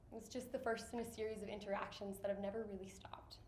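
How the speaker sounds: whispering. Real, not acted. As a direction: whisper it to yourself. Quiet reflective aside.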